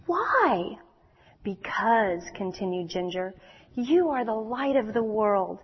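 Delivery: put-on lively storyteller voice for young listeners; children's story reading. A narrator's reading. Preacher reading a story to the children. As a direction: read it like a bedtime story to kids.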